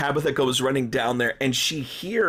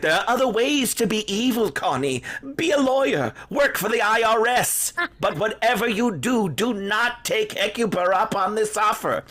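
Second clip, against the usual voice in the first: pleading warning; 7 semitones higher than usual. Retold or imitated. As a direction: beg her to pick any other evil. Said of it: "She's like".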